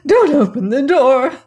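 high, loud panic; panicked cry. Acted. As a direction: cry out in terror, pitched high. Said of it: "cried Missus Stevens in a panic".

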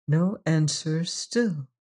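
plain reading voice

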